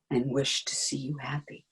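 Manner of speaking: soft hushed whisper. Acted. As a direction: whisper it tenderly to him. Jocasta soothing Oedipus.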